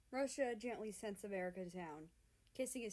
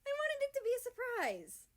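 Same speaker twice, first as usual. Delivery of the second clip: very high, loud protest; 8 dB louder than usual. A narrator's reading. America to Canada, high loud protest. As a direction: defend yourself shrilly. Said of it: "America protests".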